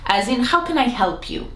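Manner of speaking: ordinary talk, nothing marked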